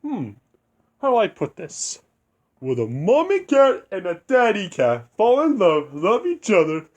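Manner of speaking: lightly hammed cartoon cat explaining the birds and bees; the cat coyly explaining where kittens come from; Cat in the Hat voice. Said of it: "And Cat would say".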